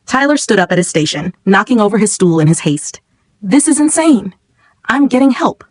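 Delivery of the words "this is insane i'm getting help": panicked. A narrator's reading. Tyler panics at fire.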